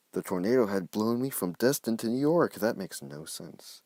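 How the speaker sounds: put-on narrating his kid-written story with drama; story narration. A narrator's reading. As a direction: read the escape like an action tale. Reading his old story aloud.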